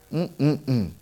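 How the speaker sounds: ordinary talk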